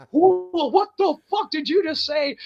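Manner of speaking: high-pitched mock astonishment; astonished crowd reaction. Imitating the crowd hearing his promo, high incredulous voice.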